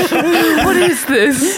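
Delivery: slow, high, laughing question; mock baffled peasant, laughing; laughing mock bafflement. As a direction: giggle through a confused peasant line.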